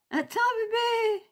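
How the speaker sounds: high, drawn-out coo with pet names. Real, not acted. Telling poulette to wait for cuddles, cooing. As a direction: coo it high, stretching the last vowel.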